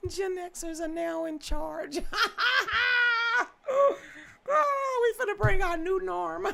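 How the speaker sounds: laughing giddily. Real, not acted. Man tells viewers Gen X is in charge, laughing giddily. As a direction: giggle while gloating about Gen X.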